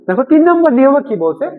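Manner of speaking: usual speaking voice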